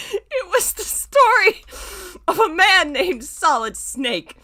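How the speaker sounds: slow, high, loud, holding in laughter; forcing the words out while laughing; strained laughing. Real, not acted.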